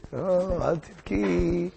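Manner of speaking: slow, drawn-out mock comforting; soothing. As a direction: coax the queen out of crying. King soothes the weeping queen. (הרגעה מוצגת, איטית ומתמשכת)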